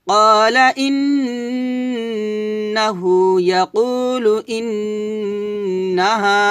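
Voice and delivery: slow melodic tajweed recitation; Quranic recitation. Acted. Teacher reciting the joined verse after spelling it. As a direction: chant the whole phrase with long held vowels.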